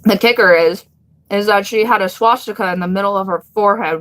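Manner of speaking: normal talking voice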